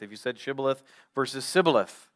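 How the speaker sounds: "shibboleth" with a clear sh, then "sibboleth" with a plain s; shibboleth versus sibboleth. Shown as a demonstration. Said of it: "the way that you pronounced the word shibboleth".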